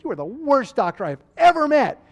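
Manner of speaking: loud, high retold outburst; pissed-off patient. Refused patient insulting the doctor over opiates, acted anger. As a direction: hurl it as an acted angry insult. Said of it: "he got really pissed off at me".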